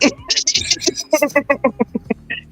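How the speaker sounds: soft, rolling laugh; long quiet run of chuckles; real giggling fit. Real, not acted. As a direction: crack yourself up at your own story. Laughing at her own story.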